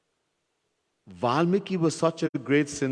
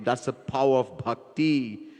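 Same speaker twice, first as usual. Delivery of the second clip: ordinary talk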